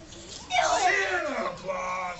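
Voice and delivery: high, slow, loud "Santa Claus?"; stunned realization. Acted. Mr Smith realizing the robber was Santa, slow and amazed. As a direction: draw it out in amazement.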